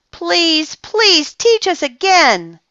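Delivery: put-on high, slow childlike begging; pleading children. A narrator's reading. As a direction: beg the teacher sweetly. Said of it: "each one said".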